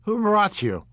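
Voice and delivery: high, loud, abrupt ask; demanding question. A narrator's reading. Drunk guest demands who brought Jordan, abrupt. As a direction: put her on the spot. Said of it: "he demanded".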